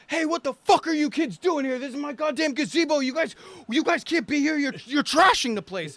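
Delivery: imitating an annoyed homeowner telling the kids off; mock angry yell.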